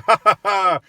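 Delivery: loud fake "ha ha ha"; mock laugh. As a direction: force a hollow laugh. Mocking customer's own joke.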